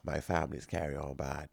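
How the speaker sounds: low, soft confidential warning; hushed warning. Retold or imitated. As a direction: murmur the warning close to her ear. Warning girlfriend before meeting family.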